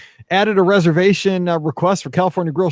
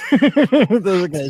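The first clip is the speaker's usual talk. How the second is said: laughing; 3 semitones higher, much slower than usual. Real, not acted. Laughing at Kate in chat.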